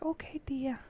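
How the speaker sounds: put-on small hushed child reply; imitating little niece. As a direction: answer softly in a little-kid voice. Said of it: "I told her last night".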